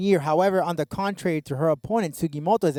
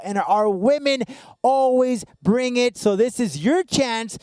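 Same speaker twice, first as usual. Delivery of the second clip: builds to a higher, shouted hype; announcer hype; 7 semitones higher than usual. Acted. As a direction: pump up the crowd, rising to a shout.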